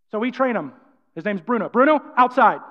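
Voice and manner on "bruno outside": loud, firm order to a dog; raised, authoritative dog command. Shown as a demonstration. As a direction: command the dog firmly, not mean.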